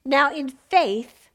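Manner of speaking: slow, weighty "Now, in faith"; slow emphasis. Real, not acted. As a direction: lean on "in faith" slowly. Preacher stressing faith.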